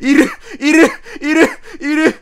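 high, loud panicked repeats; alarmed. Real, not acted. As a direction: blurt out the sighting in alarm. Spots the ghost in game.

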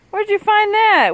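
high, loud disgusted question; disgusted surprise. Real, not acted. Owner scolding the dog over a diaper, high and loud. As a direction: recoil at what the dog found.

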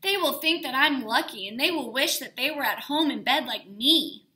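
sulky, cheeky comeback; defiant child. A narrator's reading. Sarah's defiant answer.

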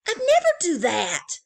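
high, loud indignant exclaim. Acted. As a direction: protest with conviction. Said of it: "exclaimed Franklin".

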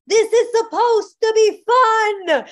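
high, loud, slow laughing line; laughing teacher. Retold or imitated. Eli telling the distressed circle, loud slow laugh. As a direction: laugh it out to lift the room. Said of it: "he goes".

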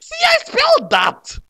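very high, loud interjection; squealed aside. Acted. Author's squealed aside in story.

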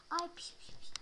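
soft hushing whisper. Pretend. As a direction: hush the doll softly. Whispering to a baby doll.

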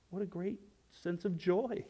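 ordinary talk, nothing marked

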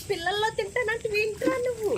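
high pet-scolding voice; chiding a pet. Real, not acted. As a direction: chide the dog, pitched high. Talking to a dog, chiding. (హెచ్చు గొంతుతో కుక్కను మందలిస్తూ)

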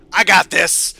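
loud confident boast; loud cocky boast; cocky shout. Real, not acted. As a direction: declare it with swagger. Boasting before boss fight.

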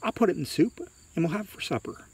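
a hint of mother planning supper; lightly voiced mother. A narrator's reading. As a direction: pitch it up for the mother.

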